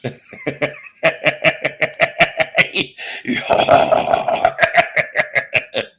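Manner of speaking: drawn-out, staccato cackle; exaggerated character laugh, rising in volume; long cackle. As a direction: cackle on and on in bursts. Cackle after request for character voice.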